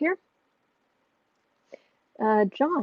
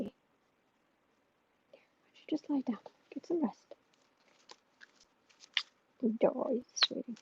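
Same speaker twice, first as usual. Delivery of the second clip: slow breathy whisper to a drowsy pet; 2 semitones higher, 9 dB quieter than usual. Real, not acted. Owner whispering to her tired cat to rest, soft whisper. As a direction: whisper him gently to sleep.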